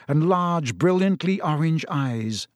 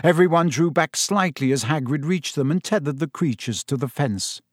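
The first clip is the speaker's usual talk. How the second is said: ordinary delivery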